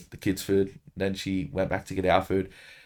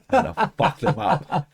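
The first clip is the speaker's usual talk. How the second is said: laughing threat; 10 semitones higher than usual. Retold or imitated. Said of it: "I'm like".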